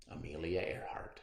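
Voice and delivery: whispered name, low and soft; a spooky whispered sign-off; dramatic whisper. Acted. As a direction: breathe the name like a mystery. Closing dramatic title read.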